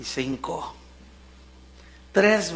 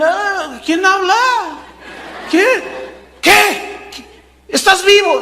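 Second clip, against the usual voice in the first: much higher, louder astonished calls; startled king; 12 semitones higher, 6 dB louder, slower than usual. Acted. King hearing Daniel alive, much higher astonished shouts. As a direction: shout in disbelief, pitch way up.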